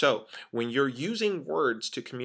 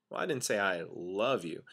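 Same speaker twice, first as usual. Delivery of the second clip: "love" held long in a lower-pitched line; stress on "love"; 3 semitones lower, 4 dB quieter than usual. Shown as a demonstration. Trainer answering what that sounds like, stress on "love". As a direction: lean on "love", hinting at some lesser feeling. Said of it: "emphasis is actually on love".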